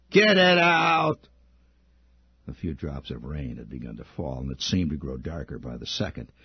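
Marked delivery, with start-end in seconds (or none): 0.1-1.3 much higher, louder and slow command; a high, loud, drawn-out demand; bully's order. A narrator's reading. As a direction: bark it slowly like a bully.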